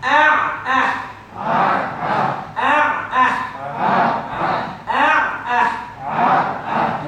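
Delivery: loud Ah, ah from mid throat; loud throat demo. Shown as a demonstration. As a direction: punch each Ah from the throat. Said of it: "We're gonna say ah and ah".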